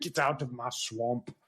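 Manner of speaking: a hint of an Austrian strongman, slow and lowish; Schwarzenegger-like Shrek. Shrek ordering intruders off, in a slow Austrian-tinged voice. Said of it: "That sounded like Arnold Schwarzenegger".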